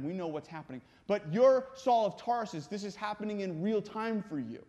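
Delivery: usual speaking voice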